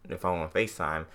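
normal talking voice

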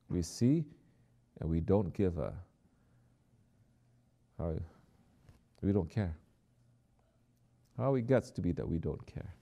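normal talking voice

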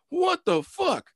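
very high, drawn-out slow what the fuck; stunned disbelief. Retold or imitated. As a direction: stretch it out, squeaking in disbelief. Retelling his reaction to her.

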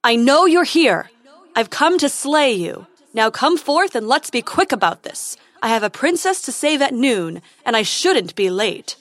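loud heroic challenge; calling out. A narrator's reading. Prince challenges the hidden dragon to fight, loud and declamatory. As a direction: proclaim it boldly to a hidden foe. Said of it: "He listened to his own voice echo away".

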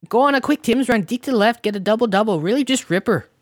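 high, punchy Canadian hoser patter; mock Canadian hoser. Podcaster confusing non-Canadians with slang, mock hoser.

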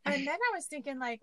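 ordinary talk

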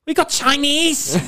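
high, drawn-out mock Scouse woman; Scouse accent. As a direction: imitate a Liverpool girl's squealed Scouse, slowly. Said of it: "one of them accents".

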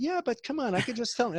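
high-pitched whiny objection, retold; pleading protest. Actor protesting to his girlfriend's advice, high-pitched mock pleading.

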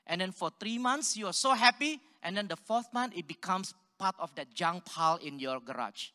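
ordinary talk

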